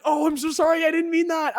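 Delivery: loud, high-pitched hammed-up apology; frantic apology. Speaker acting out apologising after sarcasm lands wrong, loud and frantic. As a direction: blurt it out high and shouted, backpedalling. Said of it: "sometimes I'm like".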